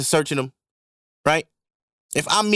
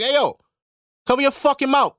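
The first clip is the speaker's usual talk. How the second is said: yelling at a sneezer; 7 semitones higher than usual. Retold or imitated. Yelling at the man who sneezed on the window. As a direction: bark at him to cover his mouth. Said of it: "I'm like".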